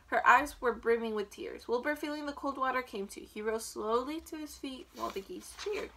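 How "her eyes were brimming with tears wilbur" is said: usual speaking voice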